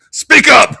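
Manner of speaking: half-joking shout at a friend; mock yell. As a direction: bark it at the mumbler in jest. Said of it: "It was just like".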